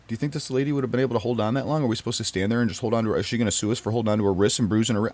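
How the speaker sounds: fast, rushed indignant questions; rapid indignant. Real, not acted. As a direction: rattle them off in disbelief.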